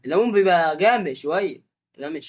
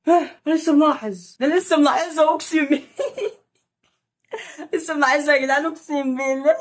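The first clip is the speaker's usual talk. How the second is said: high, laughing disbelief; shocked disbelief; 8 semitones higher than usual. Real, not acted. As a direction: swear it in shock. Shocked by what he notices. (ذهول وضحك)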